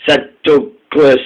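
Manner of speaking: usual speaking voice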